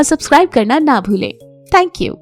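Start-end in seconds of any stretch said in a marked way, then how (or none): none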